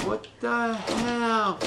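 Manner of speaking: high, stretched-out incredulous question; drawn-out disbelief. Real, not acted. Gamer baffled by the room, incredulous and drawn out. Baffled player reacting to game.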